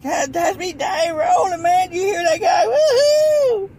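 very high, squealing mock mirth; shrieky falsetto, drawn out; hammed-up hilarity. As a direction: squeal as if helpless with amusement, ending in a whoop. Hammed-up mirth at others' last words.

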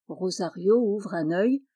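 ordinary delivery

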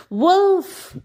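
high, loud call through cupped hands. A narrator's reading. Boy on a rock calling the villagers, loud high call. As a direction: cry out to the village below. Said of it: "he called".

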